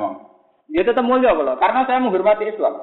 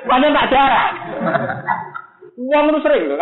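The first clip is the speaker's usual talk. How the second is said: exclaiming; 8 semitones higher than usual. Real, not acted. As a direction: call it out to the crowd. Preacher exclaims to crowd.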